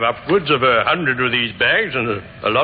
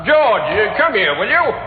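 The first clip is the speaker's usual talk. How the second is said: raised voice calling across the room; calling George over, raised and high; 8 semitones higher, 3 dB louder than usual. Acted. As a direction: summon him from the back, loudly. Calling brother from another room.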